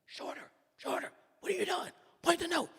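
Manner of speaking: very high, loud exasperated yelling. Retold or imitated. Bandleader yells at the young trumpeter all night, shrill loud yelling. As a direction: squeal the orders in exasperation. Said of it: "Same thing the whole night".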